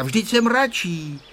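high, slowed protest; high-pitched, drawn-out objection; contradicting. A narrator's reading. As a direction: insist on the opposite. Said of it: "odporoval Ferda".

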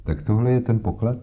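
coolly asked question, lightly voiced; understated coolness; rather cool. A narrator's reading. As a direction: ask with mild, detached interest. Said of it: "zeptala se dost chladně".

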